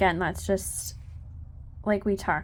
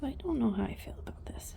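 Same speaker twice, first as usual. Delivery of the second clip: soft whisper for the unsure mare; quiet whispered pet voice; whispered horse voice; 2 semitones higher, 11 dB quieter than usual. Retold or imitated. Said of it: "She says".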